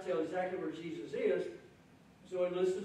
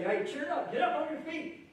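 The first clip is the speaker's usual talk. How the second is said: higher, louder, slowing at the end; eager encouragement; 9 semitones higher, 3 dB louder than usual. Retold or imitated. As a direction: rouse the blind man with good news. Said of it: "they say".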